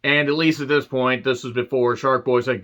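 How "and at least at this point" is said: ordinary talk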